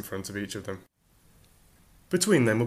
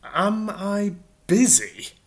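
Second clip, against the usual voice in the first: much higher, slow, dripping sarcasm; sarcastic exclamation; 10 semitones higher, 6 dB louder, much slower than usual. Acted. Arthur answering Ford's question, sarcastic exclamation. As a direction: throw the question back in disbelief. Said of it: "exclaimed Arthur".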